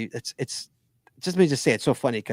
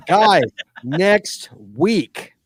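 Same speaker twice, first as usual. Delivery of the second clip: announcer voice; 4 semitones higher, 8 dB louder, much slower than usual. Acted. Announcing next week's show.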